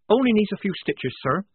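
cheerfully reassuring the officer. A narrator's reading. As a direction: brush it off brightly. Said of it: "said Polly cheerfully".

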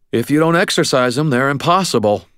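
usual speaking voice

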